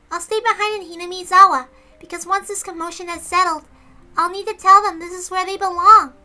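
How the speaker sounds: put-on high, loud little girl; Rika's little-girl voice. Little girl Rika telling friends she'll stay. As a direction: pitch up high and childlike.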